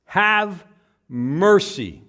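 loud commanding demand. Shown as a demonstration. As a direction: bark it as an order. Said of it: "in a command".

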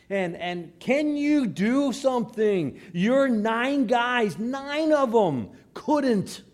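loud, high, slow desperate demand; passionate plea. Acted. Despairing father to Jesus about nine disciples, passionate plea. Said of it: "a real passion in his heart".

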